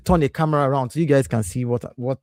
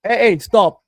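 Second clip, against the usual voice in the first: loud stop; 8 semitones higher, 7 dB louder, slower than usual. Real, not acted. Kids fighting in office.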